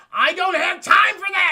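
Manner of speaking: furious high-pitched yell; aggressive shout. Acted. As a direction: explode with impatience at full volume. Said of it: "He's getting very aggressive".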